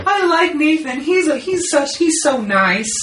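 high, giggly, mock-sweet girl voice; coy girlfriend. Mocking his friend's girlfriend smoothing things over, high and giggly. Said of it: "some kind of very coy thing".

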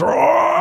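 high, shouted mock roar; mock grunt roar. Imitating the villain grunting alone in a room, mock roar. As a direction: ham up a silly grunting roar. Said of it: "like, grunts. He goes".

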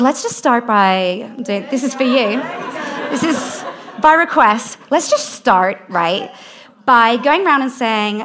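drawn-out Valley girl imitation. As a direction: put on a slow Valley girl voice. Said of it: "I don't know why she's a Valley girl".

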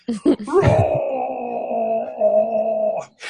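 long, high mock howl; Klingon death howl. Joking that some Klingon just died, long mock Klingon howl. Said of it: "releasing your inner Klingon".

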